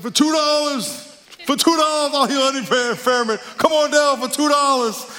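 high, hammed-up revival preacher pitch; mock preacher hawking miracles in a high, strained cry; Richard Pryor's faith healer. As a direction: call the crowd down like a huckster healer. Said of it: "faith healer, preacher".

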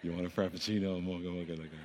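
joking low murmur; very soft and low, sham-holy hush; mock small, still voice. Preacher spoofing God's voice to order coffee, hushed and low. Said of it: "Don't be trying to get over using God's voice".

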